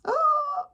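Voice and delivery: high, loud acted gasp; startled gasp. A narrator's reading. Crowd freezes as the bear sneezes, startled gasp. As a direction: gasp as if caught out.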